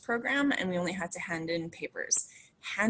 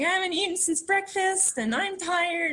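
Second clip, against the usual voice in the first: complaining; 11 semitones higher, 4 dB louder than usual. Retold or imitated. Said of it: "I'm like".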